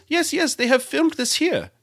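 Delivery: high, lilting mock Icelandic accent; Icelandic-accented guide confirming proudly; Icelandic guide's accent. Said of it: "he goes".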